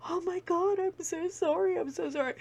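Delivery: high-pitched, breathless apologizing; flustered apology. Retold or imitated. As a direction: squeak out a mortified sorry. Mortified dog owner apologizing.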